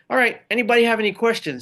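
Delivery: quick, high, offhand "all right" as if there is nothing left to say; buddy cheerfully skipping his speech, breezy and quick. Retold or imitated. Buddy Pete casually skips his speech.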